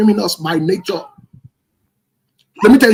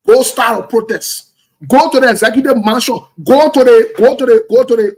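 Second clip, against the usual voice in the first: louder, higher rallying call to march; urging shout; 3 semitones higher, 6 dB louder than usual. Real, not acted. Talk show host rallies young Liberians to protest, raised rallying shout. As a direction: push the crowd toward the mansion.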